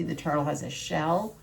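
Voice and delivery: ordinary delivery, nothing marked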